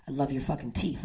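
low, soft imitation of a lover; mock-seductive low murmur; murmured come-on. Man murmurs during makeout.

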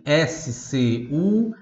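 letters S C U S I spoken slowly one by one; teacher spelling letter by letter. Shown as a demonstration. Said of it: "se escreve".

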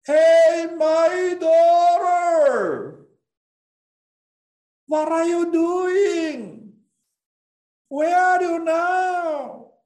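high, loud, slow sung call that ends in a shout; God calling out loud. Acted. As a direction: call across the distance to a lost daughter, full voice. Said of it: "Father calling to each one".

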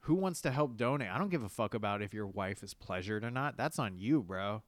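normal talking voice

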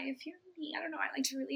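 hushed whisper; whispered aside. Real, not acted. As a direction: drop to a secret whisper. Whispered aside about getting high.

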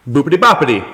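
put-on hammy Italian accent, half laughing; silly cartoon Italian accent. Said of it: "I can speak in an Italian accent".

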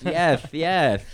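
high, loud lisped yes; Mike Tyson. Retold or imitated. Answering the dentist's receptionist, Mike Tyson lisp. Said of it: "I feel like it's Mike Tyson".

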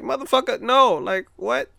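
high-pitched, incredulous retort; mock indignation. Answering fans who jumped on the hype, indignant disbelief.

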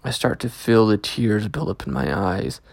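ordinary delivery, nothing marked

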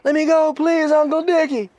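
very high, loud mock begging; imitated captive crying uncle at the top of the voice; desperate pleading captive. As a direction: squeal out the forced plea. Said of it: "And the guy goes".

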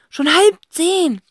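put-on slow, loud alarmed girl. A narrator's reading. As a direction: gasp the time. Cassie alarmed.

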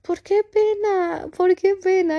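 high, drawn-out incredulous question; pleading, high-pitched objection; exasperated protest. Acted. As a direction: stretch it out in mock dismay. Rebuts her old apology for crying.

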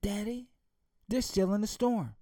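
put-on high, dawning girl's voice; realizing daughter. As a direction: let the child's voice lift with realization. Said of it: "She said".

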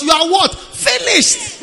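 usual speaking voice

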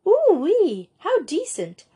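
high, swooping delighted squeal; startlingly enthusiastic, pitch leaping up; wild enthusiasm. A narrator's reading. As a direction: exclaim it with schoolgirl glee. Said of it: "such wild enthusiasm".

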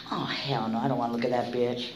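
quick low refusal, kept to yourself; retold private aside. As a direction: say it under your breath, dismissively. Retold private reaction to beautiful girl.

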